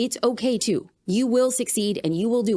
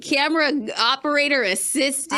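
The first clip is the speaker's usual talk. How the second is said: playful hype; 7 semitones higher than usual. Real, not acted. Guest playfully praises host's husband behind the camera, hyped up. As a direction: sell him like a game-show host.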